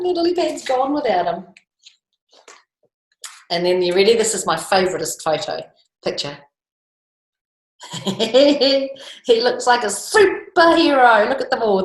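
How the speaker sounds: ordinary delivery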